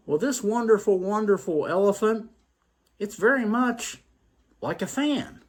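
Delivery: high, excited voice; voiced blind man. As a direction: lift the pitch in eager wonder. Fifth blind man quoted.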